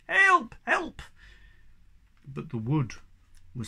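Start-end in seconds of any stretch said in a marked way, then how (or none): 0.1-1.0 high, loud cry at the top of his voice; desperate yell; yell for help. A narrator's reading. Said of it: "yelled at the top of his voice".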